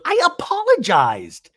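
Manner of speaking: high, drawn-out mock wail; hammed-up high-pitched protest; protesting apology. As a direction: imitate an indignant, defensive cry. Retold protest in quarrel.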